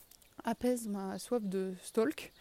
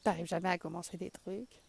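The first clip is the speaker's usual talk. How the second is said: inner critic voice. Retold or imitated. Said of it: "mes petites voix négatives dans la tête qui disent".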